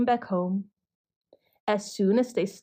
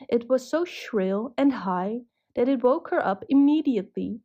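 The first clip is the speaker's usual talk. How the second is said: plain reading voice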